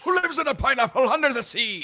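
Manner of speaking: loud, high sing-song pirate shout; cartoon pirate captain. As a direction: belt it out like a cartoon sea captain. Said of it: "that was a great impression".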